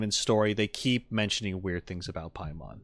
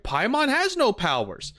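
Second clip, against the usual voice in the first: mock exclamation; 12 semitones higher than usual. Exclaims the game character's claim.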